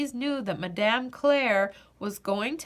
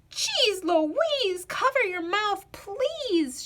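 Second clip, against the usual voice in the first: higher, cross ballerina shout; 9 semitones higher, slower than usual. A narrator's reading. Amanda shouting at Louise after falling, high and cross. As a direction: shout it at her from the floor. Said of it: "shouted Amanda".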